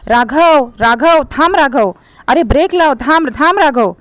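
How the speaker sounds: loud frantic screaming of his name; terrified shout to brake; panicked shout. A narrator's reading. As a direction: yell at him to stop before the crash. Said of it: "मागे बसलेली रागिनी ओरडली".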